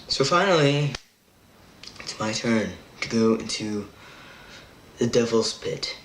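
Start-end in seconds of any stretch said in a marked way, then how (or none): none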